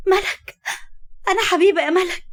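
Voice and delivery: high, urgent and breathless; eager plea. Acted. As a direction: rush to identify herself, desperate. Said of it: "حبيبة بلهفة". (لهفة عالية مستعجلة)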